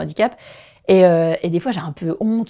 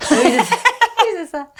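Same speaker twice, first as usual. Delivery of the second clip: agreeing through real laughter, much higher; laughing; 13 semitones higher, 4 dB louder than usual. Real, not acted. Laughing along with her co-host, agreeing. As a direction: let the laugh carry the words, high up.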